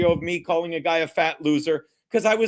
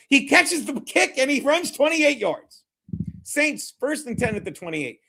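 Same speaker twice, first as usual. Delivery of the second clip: higher, drawn-out kickoff call; play-by-play call; 5 semitones higher than usual. Real, not acted. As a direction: call the return with rising excitement.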